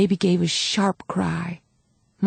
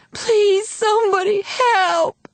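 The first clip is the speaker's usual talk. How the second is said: high, sobbing plea; screaming for help through labour pain; desperate crying; 14 semitones higher, 3 dB louder, slower than usual. A narrator's reading. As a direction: beg anyone at all, in tears. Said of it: "she cried".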